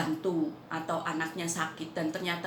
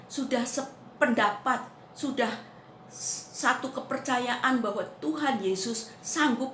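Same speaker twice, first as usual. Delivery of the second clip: raised, emphatic proclamation; fervent preaching; 4 semitones higher, 4 dB louder than usual. Real, not acted. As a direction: drive each repeated phrase home with a strong opening. Preacher proclaims at full force. (khotbah lantang dan berapi-api)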